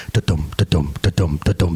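very low heartbeat imitation; pounding heartbeat. Employee's heart pounding under boss's rant, low thudding imitation. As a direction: thump it out deep and even. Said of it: "That was my heart".

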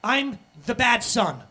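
ashamed son. Retold or imitated. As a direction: voice the son branding himself bad. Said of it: "you're like".

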